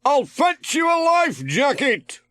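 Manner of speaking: Ted shouting. A narrator's reading. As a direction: shout it to Pat from the sailing boat. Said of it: "Ted shouted".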